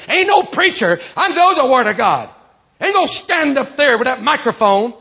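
cocky, high-pitched defiant rant; arrogant preacher. Acted. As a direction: act out a lawless preacher refusing all correction. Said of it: "the spirit behind what I'm trying to act out".

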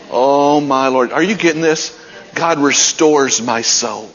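ordinary delivery

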